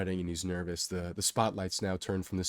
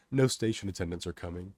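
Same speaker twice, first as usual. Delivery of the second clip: ordinary delivery, nothing marked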